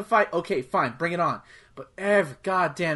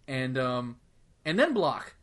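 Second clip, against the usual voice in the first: ordinary talk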